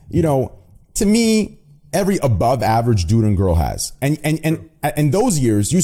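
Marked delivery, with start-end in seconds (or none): none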